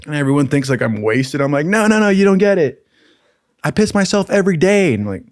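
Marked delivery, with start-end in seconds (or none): 1.7-4.9 retold high-pitched protest; insistent protest. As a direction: insist in a raised voice, imitating yourself. Protesting to people thinking him drunk.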